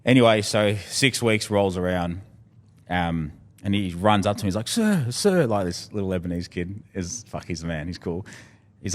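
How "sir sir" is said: high, eager sir sir; excited kid calling out. Retold or imitated. Little Lebanese kid runs up to his coach, high and eager. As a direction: call out like a keen young boxer.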